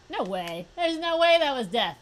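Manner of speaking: incredulous outburst at an unfair result; loud disbelief. Real, not acted. Protests a death in game.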